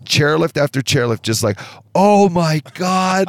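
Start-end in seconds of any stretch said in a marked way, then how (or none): 1.9-3.3 long, high drawn-out mock shock; imitated crowd gasping. Chairlift riders watch the crash, imitated drawn-out horror. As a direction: stretch it out like horrified onlookers, pitched up. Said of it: "the, the sounds. ... Everyone".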